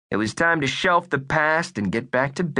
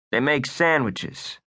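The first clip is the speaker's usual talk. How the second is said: plain reading voice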